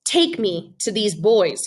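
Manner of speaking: put-on Abel telling the boy to take him to the bullies, calm and deliberate. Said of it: "very calm and deliberate".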